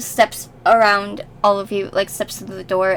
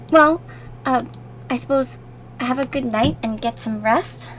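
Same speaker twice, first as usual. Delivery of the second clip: ordinary talk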